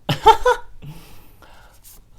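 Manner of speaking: loud, high burst of laughter; sudden high-pitched laugh at herself; real laugh. Real, not acted. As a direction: crack up at your own silly accent. Laughs at own accidental accent.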